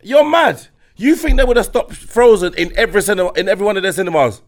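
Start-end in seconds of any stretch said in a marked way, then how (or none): none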